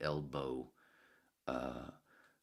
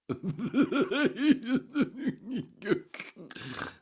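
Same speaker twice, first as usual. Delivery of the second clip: big laugh; 12 dB louder than usual. Real, not acted. Bursts out laughing.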